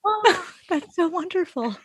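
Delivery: high, sighing delight; melting gratitude. Acted. Listener acting out being comforted, melting and grateful. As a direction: gush it softly as if deeply comforted. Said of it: "And she just goes".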